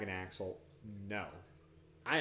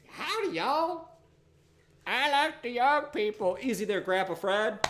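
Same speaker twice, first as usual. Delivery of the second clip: hammed-up cowboy; 9 semitones higher, 4 dB louder than usual. Greeting the travelling party, hammed-up cowboy voice. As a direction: play a folksy pioneer saying howdy.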